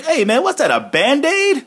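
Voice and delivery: mock disbelief at a Band-Aid in the food; high, amused wrestler voice asking what that is; imitating Big E.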